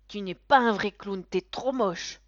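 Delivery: put-on loud taunting child; insulting boy. A narrator's reading. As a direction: jeer at the clown like a nasty kid. (enfant moqueur et fort)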